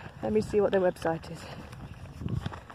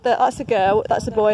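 ordinary delivery

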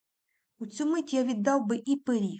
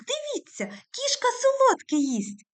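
much higher and louder, slowed; child's shout; 8 dB louder than usual. A narrator's reading. Petryk calls out to everyone, high and loud. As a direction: call out amazed to the table. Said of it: "гукнув Петрик".

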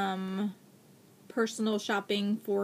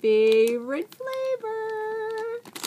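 excited squeal; 14 semitones higher than usual. Real, not acted. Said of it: "So excited".

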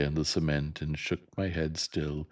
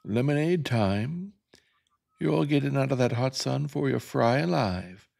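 yelled call; 10 semitones higher, 5 dB louder than usual. Acted. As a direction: call the kids in from the porch. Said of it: "appeared in the front door and yelled".

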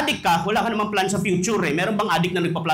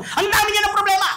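exclaimed; 14 semitones higher, 3 dB louder than usual. Real, not acted. Exclaiming about problems.

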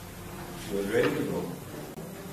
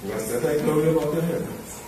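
scripture recitation. Acted. Mourner reciting a psalm for his late teacher, measured. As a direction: recite it like a Sunday school lesson.

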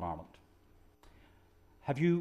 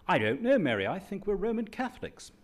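higher and louder, a boy's put-on English accent; 10 semitones higher, 7 dB louder than usual. Schoolboy answering the headmaster in a put-on English accent. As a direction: do your best English accent, voice pitched up. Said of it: "I said in my best English accent".

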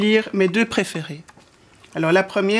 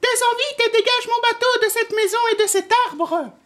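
very high, squeaky mouse voice; little mouse crying out. A narrator's reading. Mouse ordering the wind to push his boat, high-pitched cry. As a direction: pipe up as a tiny mouse giving orders. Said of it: "s'écria le souriceau".

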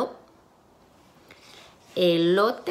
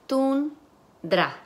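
Tundra split as "tun... dra", pitched higher; syllable by syllable; 6 semitones higher than usual. Shown as a demonstration. Teacher segments the word for students, syllable by syllable. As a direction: break Tundra into two separate syllables. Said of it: "van a segmentar sílabas".

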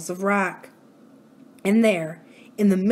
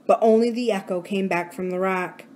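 usual speaking voice

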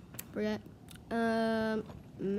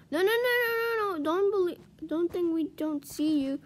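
high, loud string of alarm; urgent protest; 7 semitones higher, 3 dB louder than usual. Real, not acted. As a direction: cry out to fend off an attacker. Spotting an attacking enemy.

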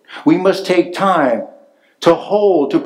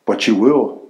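lightly put-on Russian accent, barely shifted; a hint of a Russian accent, teasing; mock Russian accent; 4 semitones lower than usual. Russian friend teasing the pastor, faint Russian accent.

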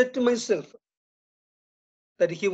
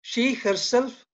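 She herself said slowly, pitched up on She; teacher's emphatic stress on She herself; 5 semitones higher, slower than usual. Shown as a demonstration. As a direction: lean on She and draw out herself.

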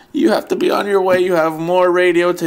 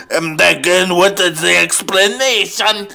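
normal talking voice

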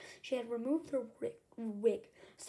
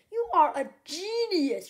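high, loud, drawn-out praise; delighted cry; 6 semitones higher, 9 dB louder, slower than usual. A narrator's reading. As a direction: exclaim with overjoyed admiration. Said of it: "Trap cried".

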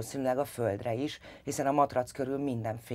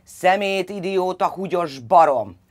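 loud, higher furious yelling; yelling insults; 7 semitones higher, 8 dB louder, slower than usual. A narrator's reading. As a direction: hurl each insult at the child. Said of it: "üvöltött rá".

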